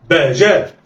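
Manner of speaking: "باء جاء" joined in one quick go; snatch "باء جاء" without a gap; snatched together. Shown as a demonstration. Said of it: "دي طريقة الخطف".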